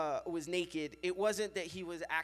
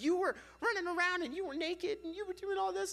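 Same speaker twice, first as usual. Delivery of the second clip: higher put-on scolding voice; wife mocking the king's dancing, higher; 12 semitones higher than usual. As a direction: scold him in a higher mock voice. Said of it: "when she's like".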